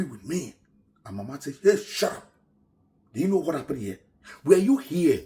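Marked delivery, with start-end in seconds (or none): 1.6-5.2 high, loud telling-off; angry scolding. Retold or imitated. Mama scolding the angry neighbour woman. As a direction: shut her up hard.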